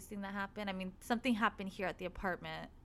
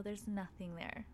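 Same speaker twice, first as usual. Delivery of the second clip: ordinary delivery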